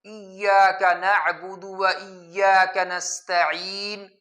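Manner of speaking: slow, measured Quran recitation. Shown as a demonstration. Teacher recites the verse for his Quran class, slowly. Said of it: "Quran class میں سورۃ الفاتحہ کی آیت".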